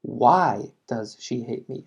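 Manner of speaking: slow model with why stressed; slow it down, leaning on why; slow classroom model, why leaned on; slow teaching read. Shown as a demonstration. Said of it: "quiero saber más información".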